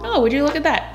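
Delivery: ordinary delivery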